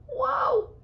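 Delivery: loud high whoa of wonder; amazed whoa. Real, not acted. Reader reacting to the peach picture, amazed. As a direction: gasp it out at the picture. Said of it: "reader's own reaction".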